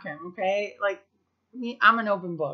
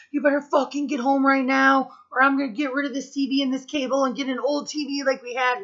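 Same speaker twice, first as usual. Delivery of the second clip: loud, higher, angry dad on the phone; angry dad yelling; 6 semitones higher, 3 dB louder than usual. Retold or imitated. Dad yelling at his kid to come home, loud and angry. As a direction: bark it down the phone like a furious father. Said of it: "my dad getting so pissed".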